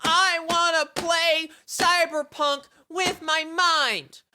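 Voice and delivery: put-on high, slow, whiny spoilt-kid voice; mock a toddler demanding his toy; whiny child. Mocking Musk as a child throwing a tantrum, high slow whine. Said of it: "He's like a child... throwing a temper tantrum".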